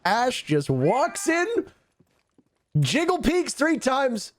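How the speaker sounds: very high, drawn-out disbelief; incredulous reaction. Real, not acted. Caster reacting to a peek that got punished, incredulous. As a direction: stretch it out high, astonished at the play.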